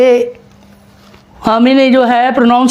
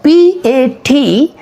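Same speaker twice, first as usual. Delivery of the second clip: spelling out slowly; much slower than usual. Shown as a demonstration. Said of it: "जैसे मैं ये कहूँगा कि ये मोनो साउंड है".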